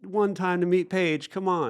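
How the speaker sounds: high, pleading imitation; mock tearful panic. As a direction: plead as a friend near tears. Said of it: "he was freaking out. He's almost in tears".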